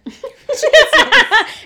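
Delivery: big high laugh; real loud laughing fit; loud burst of laughter. Real, not acted. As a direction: crack up loudly. Laughing at own quote.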